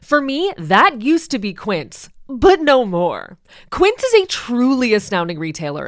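upbeat, loud ad voice; ad read. Acted. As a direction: sell it with polish. Sponsor ad read.

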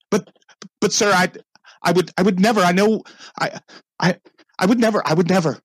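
high, fast pleading stammer; stammering denial. Acted. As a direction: beg to be believed, floored. Butler protests innocence, stammering.

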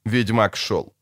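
usual speaking voice

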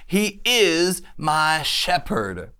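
slow, with "is" leaned on hard; stress on is. Shown as a demonstration. Preacher to congregation, stressing "is" slowly. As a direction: hit the word "is" and draw it out. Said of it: "Say is".

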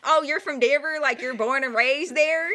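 high, loud Texan cousin voice; imitated Texan accent. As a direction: question it in a thick Texan twang. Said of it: "I can't do the accent as well as you".

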